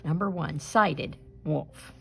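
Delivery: ordinary delivery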